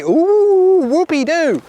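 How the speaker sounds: high, loud mock-impressed "ooh"; sarcastic. Photographer mocking gear chat between mates, sarcastic. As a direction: hoot it in exaggerated fake awe. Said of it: "I'm sorry if I sound sarcastic".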